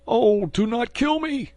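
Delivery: high, slow, pleading cry for mercy; terrified plea. A narrator's reading. Wounded bandit begs the swordsman at his throat, high terrified cry. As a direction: beg for your life. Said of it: "cried the bandit".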